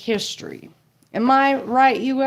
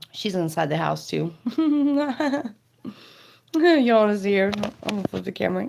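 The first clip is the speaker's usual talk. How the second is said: usual speaking voice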